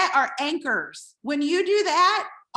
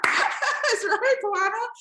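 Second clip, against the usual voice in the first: laughing; 7 semitones higher, slower than usual. Real, not acted. Laughing reply to viewer Luana.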